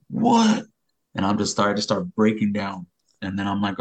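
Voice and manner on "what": high, loud, stunned question; retold shock, voice leaping up; shocked disbelief. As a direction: shoot up in pitch with alarm. Told she waited for him.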